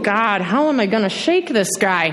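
ordinary delivery

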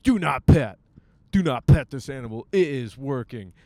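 mock warning sign. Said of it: "they're like".

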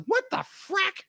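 high-pitched incredulous outburst; hammed-up exasperated shock; mock bafflement. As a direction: squeak out disbelief as the baffled viewer. Voicing a baffled viewer's outburst.

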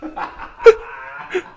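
loud high Crocodile Hunter shout; hammed-up Australian yell; mock Australian. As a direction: bellow it like a wildlife host. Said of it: "That's not it either".

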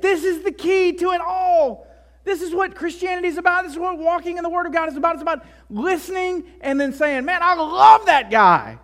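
high, loud, fervent sermon climax; soaring, urgent pulpit proclamation; impassioned preaching. Real, not acted. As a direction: preach it with full-force conviction. Preaches at full force.

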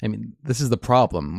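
ordinary talk

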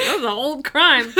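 laughing through the words. Retold or imitated. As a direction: giggle while saying it. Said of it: "It's like".

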